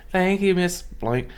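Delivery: high, slow, drawn-out thanks; polite schoolkid reply. Retold or imitated. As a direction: voice a child thanking a neighbor. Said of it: "And I'd be like".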